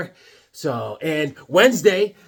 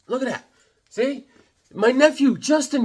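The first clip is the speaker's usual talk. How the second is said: ordinary delivery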